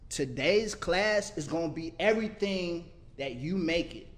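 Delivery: rally the class like a sermon; preaching poetry. Acted. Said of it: "He preaches poetry".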